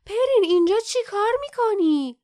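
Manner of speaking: loud, high scolding; angry. A narrator's reading. Lief to the young Kin who followed, angrily. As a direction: demand it in an angry shout. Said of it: "لیف با عصبانیت گفت".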